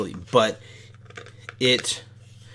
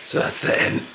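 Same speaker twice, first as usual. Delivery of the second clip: lost voice, hoarse whisper. Real, not acted. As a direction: voice gone from screaming. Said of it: "I've lost my voice".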